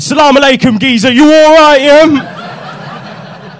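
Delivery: loud, high Cockney geezer greeting; a brash East London geezer hailing his customer. Retold or imitated. Imitating a Cockney drug dealer greeting a caller. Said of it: "Cockney geezer".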